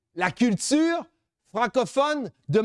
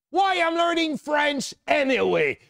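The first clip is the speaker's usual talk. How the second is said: mock sulky student in English; imitating a student; 4 semitones higher than usual. Speaker imitating a doubting student, a touch sulky. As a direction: play the bored pupil asking why. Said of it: "Combien d'élèves m'ont dit".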